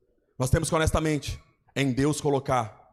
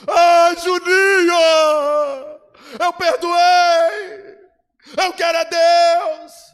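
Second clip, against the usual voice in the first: high, loud, slow wailing voice; mock crying plea; 9 dB louder, much slower than usual. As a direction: wail it out like a man sobbing his repentance. Young man crying, voiced by pastor. (lamento choroso, alto e arrastado)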